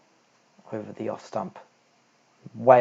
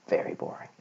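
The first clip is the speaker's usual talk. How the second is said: breathy whispered repeat, much softer; whispered aside; 9 dB quieter than usual. Real, not acted. Repeating to the viewer that maths bores him, whispered. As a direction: whisper it to yourself.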